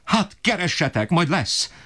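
very high, strained, desperate tirade; father berating the family for lack of bread, shrill-high rage; raging father. Acted. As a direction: screech out the injustice of it all.

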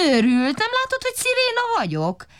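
very high, fast indignant squeal; siren crow voice. A narrator's reading. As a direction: shriek it like a wailing siren. Siren girl indignantly insists.

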